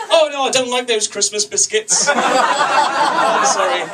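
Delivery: high, mock-fussy refusal; clueless expat. Clueless expat misunderstands, mimicked.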